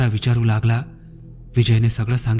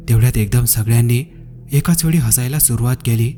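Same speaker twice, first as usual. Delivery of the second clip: ordinary delivery, nothing marked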